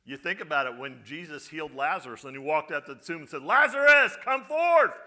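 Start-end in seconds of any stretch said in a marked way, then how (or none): 3.4-5.0 loud, high commanding call; booming command; strong voice. Acted. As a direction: call him out of the tomb with a strong voice. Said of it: "with a strong voice".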